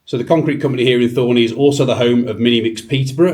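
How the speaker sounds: loud, bright TV-host intro; upbeat presenter. Acted. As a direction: pitch it like a cheery show opener. Upbeat vlog intro again.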